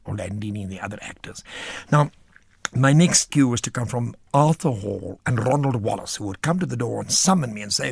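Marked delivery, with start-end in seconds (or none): none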